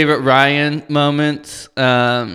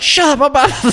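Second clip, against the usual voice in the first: high, loud laughing yell; mock yelling; 13 semitones higher, 4 dB louder, faster than usual. Gabe yells at Andy, high laughing shout. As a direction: yell it while cracking up. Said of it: "when he's yelling at Andy".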